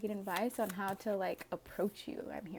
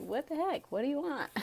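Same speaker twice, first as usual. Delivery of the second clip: higher mock-annoyed question; 5 semitones higher than usual. Aquarius mock-annoyed at the starer, higher. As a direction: pretend to be bothered by the stare. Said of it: "It's like".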